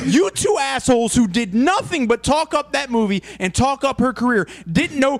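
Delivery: worked-up rant. Real, not acted. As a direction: cut in, fired up and incredulous. Outraged rant at co-hosts.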